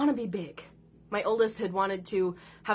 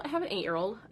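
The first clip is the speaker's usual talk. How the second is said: usual speaking voice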